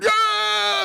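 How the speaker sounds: high, drawn-out "yeah" yell; retold cheer. Fan jumping up when the Vikings score, high cheering yell. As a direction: re-enact a fan's triumphant shout.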